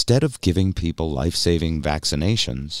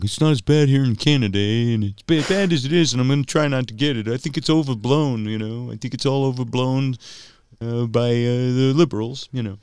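higher-pitched bad Canadian accent; hammy Canadian "eh" voice; attempted Canadian accent; 6 semitones higher than usual. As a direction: imitate a Canadian friend, amateurishly. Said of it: "trying to do a Canadian accent".

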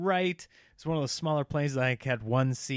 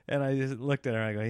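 ordinary talk

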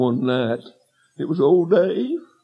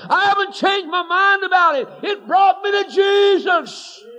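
preacher's shout. Acted. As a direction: shout it out to the congregation. Preacher's climax.

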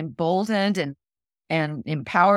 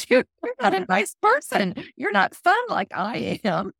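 put-on high, childish protest through laughter; squeaky, laughing self-parody; laughing mock retort; 13 semitones higher than usual. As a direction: object in mock indignation, giggling.

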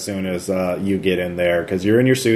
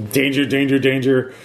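robot alarm; 3 semitones higher than usual. Acted. Alarm blaring in the vehicle.

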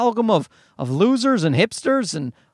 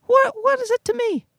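defiant shrug. Real, not acted. Defiant inner voice, pitch high.

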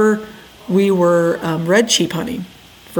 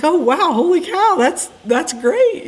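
high-pitched imitation of an amazed friend; retold amazement; 10 semitones higher, slower than usual. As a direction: mimic his wowed reaction, pitched well up. Retold friend's reaction to the news.

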